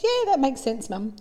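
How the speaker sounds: put-on high-pitched child agreeing with mum; little boy. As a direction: pitch it up like a small boy answering his mother. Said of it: "says little Johnny".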